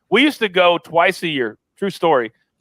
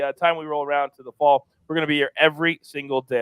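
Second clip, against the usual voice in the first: ordinary talk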